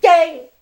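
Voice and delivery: high, loud fleeing yelp; panicked yelp. Retold or imitated. Man bolting from knife-wielding attacker mid-apology, panicked yelp. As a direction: burst into a squealed cry.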